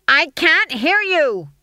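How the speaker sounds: high, slow, loud bellow; drill sergeant yell. A narrator's reading. Stage manager to timid witches, bellowing. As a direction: goad them to shout.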